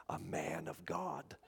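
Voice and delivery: hushed, slowed mock reverence for the title; mock awe. Pastor mocking how people revere a man of God.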